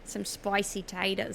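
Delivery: slow, put-on Australian "Some spicy taters"; mock Australian accent. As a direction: drawl it out like an Aussie. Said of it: "work on my Australian accent".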